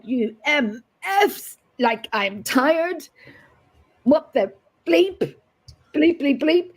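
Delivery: mock angry cursing, high and bleeped; hammed-up rant about being tired; half-mock outburst, bleeping out the swears; retold swearing. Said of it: "I was effing and I was blinding".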